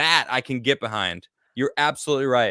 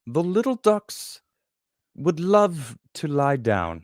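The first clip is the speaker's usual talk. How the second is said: slow, drawn-out fake English accent; hammed-up, unconvincing English accent; mock English accent; slower than usual. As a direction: put on a bad English accent, slowly. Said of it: "say it with an English accent".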